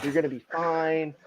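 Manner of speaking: soft, soothing coo to a rattled pitcher; baby him like a mom calming a kid. Retold or imitated. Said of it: "I have to play mom".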